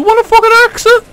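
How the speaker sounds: high, loud disbelieving yell at the screen; incredulous yell. Real, not acted. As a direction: shout it up high, can't believe it. Yelling at game character.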